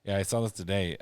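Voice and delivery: ordinary delivery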